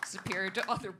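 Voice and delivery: normal talking voice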